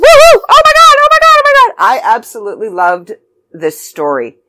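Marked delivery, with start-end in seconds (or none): 0.0-1.8 very high, loud excited shriek; re-enacted burst of giddy excitement; ecstatic fan squeal. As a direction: squeal it out like an overjoyed reader. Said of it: "I'm like".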